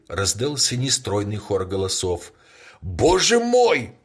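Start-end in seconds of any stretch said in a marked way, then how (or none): 2.8-3.9 high, slow cry of alarm. A narrator's reading. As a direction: cry out in shock. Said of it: "вскричал он". (громкий испуганный возглас)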